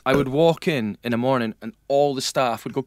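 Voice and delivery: normal talking voice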